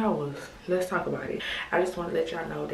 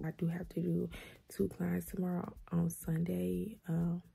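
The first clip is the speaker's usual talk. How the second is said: whispering; 8 dB quieter than usual. Real, not acted. Late night, sleepy talk.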